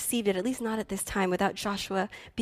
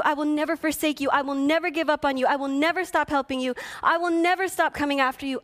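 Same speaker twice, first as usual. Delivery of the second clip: preaching at full force; 6 semitones higher than usual. Acted. Pastor voicing God's promise to the congregation, preaching hard.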